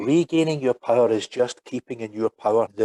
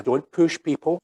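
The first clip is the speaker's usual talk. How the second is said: ordinary talk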